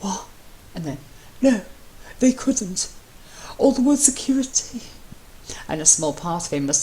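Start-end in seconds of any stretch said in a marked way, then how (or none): 0.0-2.9 gasping. Acted. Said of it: "Gasping out". 3.6-4.8 high panicked voice. Acted.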